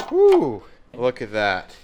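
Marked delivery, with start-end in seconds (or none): 0.1-0.6 high, loud cheer; excited whoop. Real, not acted. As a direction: let out a big happy whoop. Cheering as the part comes off.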